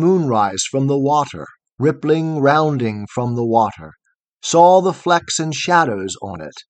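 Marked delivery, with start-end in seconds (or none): none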